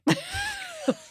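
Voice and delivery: high, squealing laugh; high burst of laughter. Real, not acted. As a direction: laugh out in disbelief. Laughing at absurd memory.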